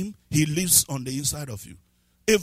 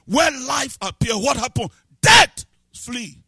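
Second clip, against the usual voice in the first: fiery preaching; 6 semitones higher, 8 dB louder than usual. Acted. Preaching at full force.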